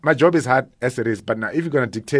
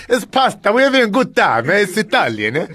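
loud, high mock-Italian accent; playful stab at an Italian accent; hammed-up Italian, much higher than usual; attempted Italian accent; 9 semitones higher, 4 dB louder than usual. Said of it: "trying to be Italian".

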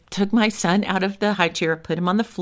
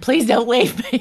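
mock begging; 5 semitones higher than usual. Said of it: "I used to beg my husband".

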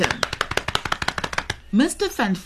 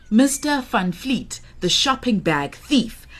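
plain reading voice

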